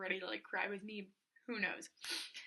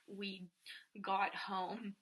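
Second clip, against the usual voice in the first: ordinary talk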